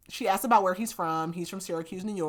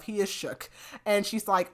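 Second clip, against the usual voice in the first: ordinary talk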